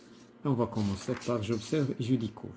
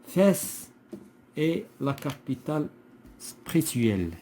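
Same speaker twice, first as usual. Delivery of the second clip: raised voice, broken into phrases; deliberate classroom verse reading; poem reading aloud; 4 semitones higher, slower than usual. Shown as a demonstration. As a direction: recite with pauses between phrases, starting high. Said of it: "on va lire le poème".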